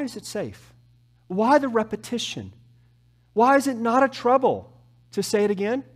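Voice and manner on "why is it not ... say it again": ordinary talk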